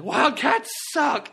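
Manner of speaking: slow, high jeering yell; mock yell. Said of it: "and he was like".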